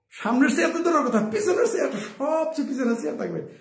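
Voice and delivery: giggling through the words at his own sidelining; high laughing self-mockery. Real, not acted.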